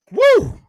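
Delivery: loud high woo of excitement; excited whoop. Real, not acted. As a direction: let out a big celebratory whoop. Host hyped about Miami news.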